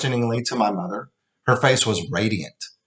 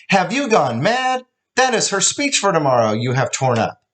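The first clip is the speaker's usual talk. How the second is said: shocked shout; 8 semitones higher than usual. Acted. Mother screaming at husband who tore the speech. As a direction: shriek it at him in horror.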